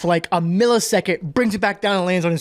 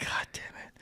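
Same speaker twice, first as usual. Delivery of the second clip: breathy whispered curse under the breath; 18 dB quieter than usual. Retold or imitated. Man at Chipotle whispering to himself, exasperated. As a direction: whisper the curse so he can't hear. Muttered reaction to the stranger.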